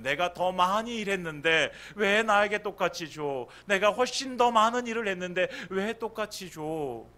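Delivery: 더 많이 일했는데 왜 똑같이 주냐며 억울하게 따지듯; indignant complaint. Retold or imitated. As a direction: complain like a worker who feels cheated. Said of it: "이 생각이 드는 거예요".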